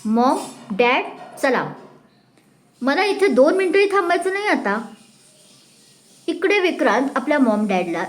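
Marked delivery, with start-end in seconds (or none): 0.0-4.8 voiced as a young man, fed up; eager to leave right now; irritated son. Son to his parents, fed up and impatient. Vikrant angrily wants to leave.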